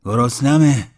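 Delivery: a starving cry; shouting. Acted. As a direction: yell the word out. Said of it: "داد زد".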